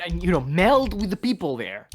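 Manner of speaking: normal talking voice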